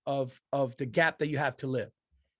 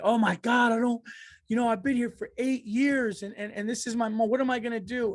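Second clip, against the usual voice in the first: mock panic; 8 semitones higher, 3 dB louder than usual. Panicking worker quoted.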